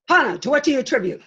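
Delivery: stiff rookie reporter. Shown as a demonstration. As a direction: rattle it off like a frozen rookie with rigor mortis. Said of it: "It was like this".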